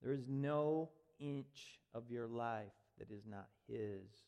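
hushed earnest conviction. Acted. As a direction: drop it low and let it land. Quiet slow sermon point.

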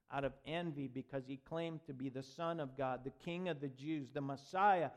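ordinary talk, nothing marked

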